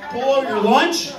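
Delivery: ordinary delivery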